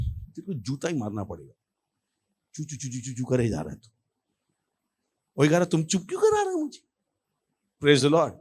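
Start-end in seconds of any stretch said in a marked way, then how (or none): 0.1-3.9 very soft, low grumbling; muttered threat. Retold or imitated. Irritated brother threatening the noisy boy, muttered. 5.6-6.8 soft, high-pitched complaint; squeaky hushed protest. Retold or imitated. Boy asking brothers why they shush him, soft high protest.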